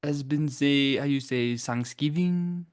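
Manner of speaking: Thanksgiving with s for th, nasal vowels; thick French accent. Pretend. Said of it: "we doing French accents".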